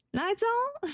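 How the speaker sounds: high-pitched first try at a game character; trial character voice. Acted. Trying out a voice for a game character, high-pitched questioning call. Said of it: "I'll make him sound vaguely Texan".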